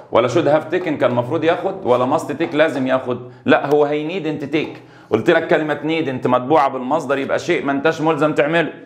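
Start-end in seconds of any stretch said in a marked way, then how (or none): none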